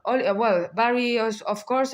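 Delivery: normal talking voice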